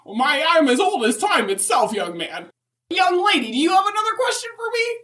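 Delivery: a fussy grandma rushing on to the next question; Mrs Claus voice. Acted.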